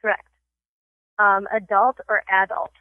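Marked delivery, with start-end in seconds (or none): 1.5-2.7 "adult" as uh-DULT, then AD-ult; two pronunciations. Shown as a demonstration. Host quizzing a guest, "adult" said both ways. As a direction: shift the stress in "adult" from back to front. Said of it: "I have a pronunciation test".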